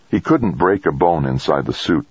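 plain reading voice

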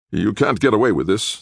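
snarling. A narrator's reading. Disarmed redhead guard defies his captors, snarling. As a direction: snarl it in defiance. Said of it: "the big redhead snarled".

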